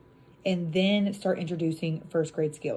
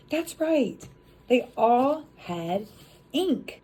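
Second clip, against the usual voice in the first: high, slow, delighted praise; encouraging praise; 5 semitones higher, much slower than usual. Shown as a demonstration. Teacher praising the class for spotting the ink sound, high and slow. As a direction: reward the class warmly. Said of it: "you guide them".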